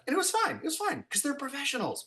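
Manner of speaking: high-pitched relieved reassurance; relieved squeak. Acted. Telling the audience the set floor survived the torch, high relieved squeak. Relief after fire test.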